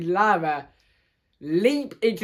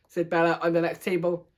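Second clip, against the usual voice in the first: usual speaking voice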